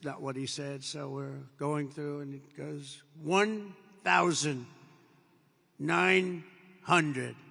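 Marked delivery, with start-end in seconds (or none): 3.2-7.4 loud, very slow altitude callout, each word spaced out; computer voice callout. Retold or imitated. Cockpit computer calling out the altitude, loud slow callout. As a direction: boom out the numbers like a cockpit computer. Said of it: "you have the computer voice".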